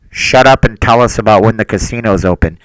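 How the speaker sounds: shouted at full volume; loud shout. Pretend. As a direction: yell it out loud. Reading a rude chat demand.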